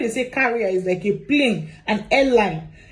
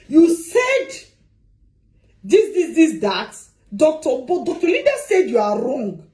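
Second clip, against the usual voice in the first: raised-pitch mock accusation; mock confrontation; 4 semitones higher than usual. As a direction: act out a client challenging her friend. Acts client confronting her friend.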